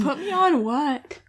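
puzzled. Real, not acted. Puzzled at lyric.

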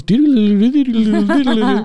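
sing-song flashback warble; dreamy flashback doodle. Pretend. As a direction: hum a wavy dream transition. Flashback wavy lines sound.

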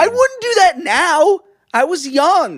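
high, loud self-defence; defensive protest. Real, not acted. Friend defending his teenage biting to shocked co-host, defensive protest. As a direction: protest it loudly, drawn out at first.

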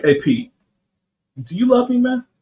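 playful high-pitched question. Retold or imitated. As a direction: tease it upward like a friend asking. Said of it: "Jesus is like".

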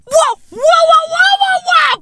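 high, shouted disbelieving whats; shocked what. Acted. Comic reacting to news with high shouted what. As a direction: yelp what over and over in disbelief.

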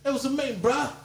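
put-on high, playful Aussie accent; mock Australian accent. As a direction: pitch it up into a jokey Australian twang. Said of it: "I think I'm Australian".

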